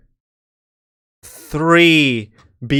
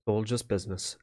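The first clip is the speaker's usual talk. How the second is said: much softer, after a sigh; cold quiet line; 4 semitones lower, 13 dB quieter than usual. Acted. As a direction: murmur it coldly over the dying god. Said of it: "she turns to Carillion and goes".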